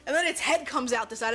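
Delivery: usual speaking voice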